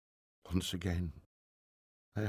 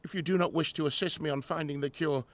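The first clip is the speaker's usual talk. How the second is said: louder, raised, dismissive; angry rebuff; 8 semitones higher, 6 dB louder than usual. Acted. Grieving professor turning on his assistant, raised and angry. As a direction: push the helper away in anger.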